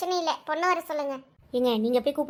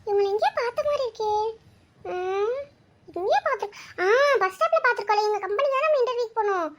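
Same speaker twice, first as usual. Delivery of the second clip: higher daughter voice, surprised to recognise him; light, eager higher voice meeting him again; daughter Anu; 4 semitones higher than usual. Acted. Daughter recognising the groom from the bus stop, higher surprised voice.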